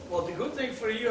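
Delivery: ordinary talk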